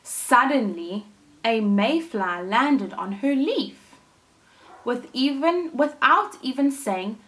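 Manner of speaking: put-on lively storytelling for children; animated read-aloud for little listeners; story narration. A narrator's reading. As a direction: read it like a picture book to a class. Teacher reads story aloud.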